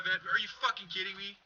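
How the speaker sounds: normal talking voice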